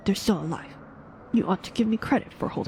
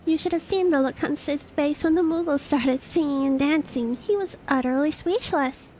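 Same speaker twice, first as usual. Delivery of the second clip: lively, higher youthful voice; young companion voice; 7 semitones higher than usual. Acted. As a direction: tell it like an excited youngster. Storyteller voicing young companion.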